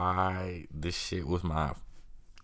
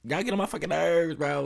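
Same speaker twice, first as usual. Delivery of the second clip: much higher, louder, fed-up outburst; real exasperation; 11 semitones higher, 4 dB louder than usual. Real, not acted. Depressed host snapping at his family listeners, real exasperated outburst. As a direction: let it burst out, worn out and furious. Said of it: "I feel myself getting very angry".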